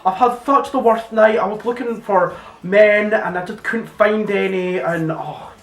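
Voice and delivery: high, loud mock wail of complaint; hammed-up high-pitched lament; Claire's moaning. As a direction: wail it high like an overdramatic colleague. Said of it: "And she'd be like".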